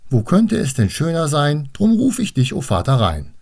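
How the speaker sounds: high, lilting recital that drops at the end; recited calling rhyme. Acted. Annabel shows her teacher the call, high recital.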